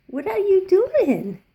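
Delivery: loud, high, slow exclamation; surprised. Real, not acted. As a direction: marvel out loud at the bird. Exclaiming at the bird.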